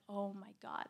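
low, soft retold "oh my God"; hushed realisation. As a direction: imitate a boy's quiet, subdued realisation. Boy reflecting on his wrongs.

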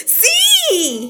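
high, drawn-out cheer; thrilled kid ready for action; eager yes. A narrator's reading. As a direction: shout it with a giant smile. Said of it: "con una sonrisa gigante y listo para la acción".

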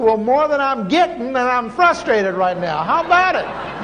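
high, exasperated whine; frustrated plea. Retold or imitated. Preacher retells complaining to God, high exasperated whine. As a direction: push back, fed up and pleading.

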